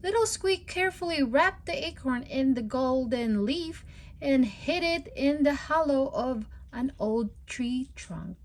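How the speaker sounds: ordinary delivery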